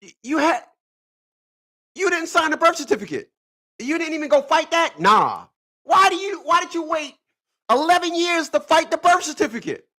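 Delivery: high-pitched, exasperated disbelief; incredulous questioning. Retold or imitated. Questioning guys who never fought the birth certificate, high-pitched incredulity. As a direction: challenge them in a high, baffled voice. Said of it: "I be saying to myself".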